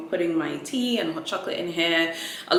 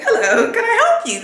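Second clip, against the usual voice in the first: mock eager sales assistant; 10 dB louder than usual. Imitating pushy sales assistant.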